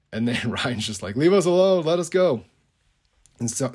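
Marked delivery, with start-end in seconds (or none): 1.2-2.4 a high, loud retold yell to be let go; desperate shout. Ryan yells at Summer to let them leave, loud and high. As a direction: shout it out, begging to escape.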